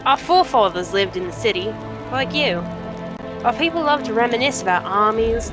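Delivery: feisty trader putting the stranger in their place; brash cave trader. Acted. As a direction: haggle like a proud junk dealer.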